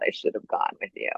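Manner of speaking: whispered regret; hushed, breathy lament. Retold or imitated. Friend's regret, retold.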